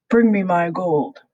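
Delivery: lightly voiced giant demand; a mild giant roar for his gold; greedy giant orders the cook, mild roar. A narrator's reading. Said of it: "roared the giant".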